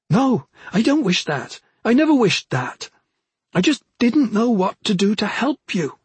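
high, urgent, earnest pleading; pleading sister. A narrator's reading. Sister begging her troubled brother to let her help, pleading.

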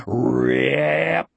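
long drawn-out, rasping rip; imitated ripping noise. A narrator's reading. The sound filling the whole world, imitated ripping noise. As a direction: stretch the word into a tearing sound.

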